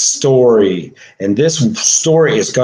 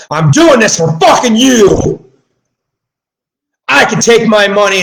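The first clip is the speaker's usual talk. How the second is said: shouting hard, much louder and higher; furious shout; 7 semitones higher, 4 dB louder than usual. Real, not acted. Ranting at the world who won't ask why, furious shout. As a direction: yell it out in real rage.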